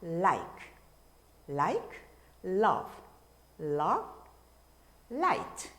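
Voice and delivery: "like", "love", "light" with the tongue tip on the upper teeth; tongue touches behind the front teeth for each "love"; "light" said slowly with a light L; clear English L. Shown as a demonstration. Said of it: "랄랄라 하듯이".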